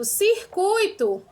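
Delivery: "circuito" said very slowly and clearly. Shown as a demonstration. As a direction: stretch "circuito" out for the class. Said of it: "Olha outro exemplo". ("circuito" bem devagar)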